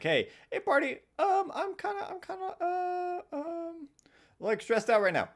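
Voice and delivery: very high squeaky voice, then slow; silly high voice. Retold or imitated. DM telling her party she is stressed, in a squeaky voice. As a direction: squeak it out like a nervous cartoon kid. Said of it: "I don't know why I did that voice for you".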